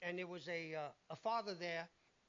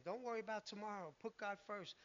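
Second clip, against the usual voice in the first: usual speaking voice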